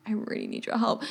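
a hushed, desperate request for help; whispered plea. Retold or imitated. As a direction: whisper it, urgent and close. Retold plea to nurse, hushed.

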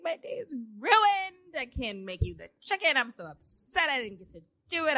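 high, hammed-up upset wail; mock distraught complaint, pitched high at first; retold upset. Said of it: "I just started crying".

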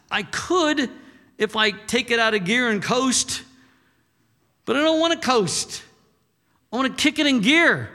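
fired-up believer. Retold or imitated. Preacher voicing a believer who refuses to coast, fired up. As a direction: push it out like someone kicking into gear. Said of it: "It's like".

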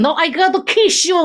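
high, hammed-up Italian accent. Presenter imitating football boss about to kiss player, mock Italian accent. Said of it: "I'm doing an Italian accent".

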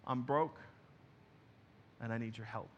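subdued, softening admission of need; quiet cry for help; humble plea. Acted. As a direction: admit it humbly, letting the voice drop. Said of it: "it's a humble cry for help".

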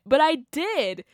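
normal talking voice

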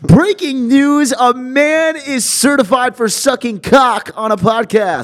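high, loud, drawn-out breaking-news announcer; mock newscaster. Podcast co-host announces fake news about his friend, mock newscaster. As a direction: belt out a slow, hammed-up news bulletin. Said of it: "You should do Bob Menery style shit".

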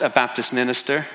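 ordinary delivery

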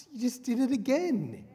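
very high, put-on daughter voice; accusing daughter. Daughter accusing her mum, in a high put-on voice. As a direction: squeak it out as the exasperated girl.

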